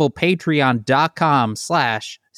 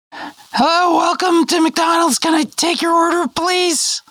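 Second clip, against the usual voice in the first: drive thru speaker; 14 semitones higher than usual. Acted. Drive-thru worker greeting.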